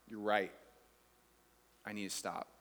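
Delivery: low, softened concession; subdued admission. Acted. Preacher conceding to his wife, subdued. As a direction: admit it quietly, pitch dropped. Gives in to wife's remark.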